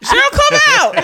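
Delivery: loud, high mock holler with laughter; laughing impression of a summons; calling out. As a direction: imitate a husband yelling through a door. Voicing husband calling, through laughter.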